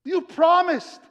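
high, protesting voice; baffled disciples. Acted. Disciples confronting Jesus over his promise, bewildered protest. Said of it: "the disciples are like".

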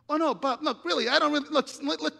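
flustered, pleading backpedal; frantic pleading. Retold or imitated. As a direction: rush to fix the mistake in a panic. Panicked pleading after the photo.

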